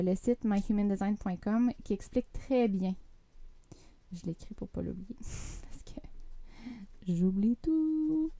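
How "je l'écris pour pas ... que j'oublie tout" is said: quiet aside. Real, not acted. Aside while writing it down.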